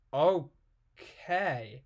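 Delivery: ordinary talk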